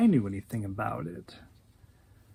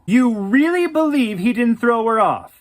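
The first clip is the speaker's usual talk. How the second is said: loud, high, indignant question; outraged disbelief; 7 semitones higher, 8 dB louder than usual. Acted. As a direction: confront him, appalled. She confronts him in a quarrel.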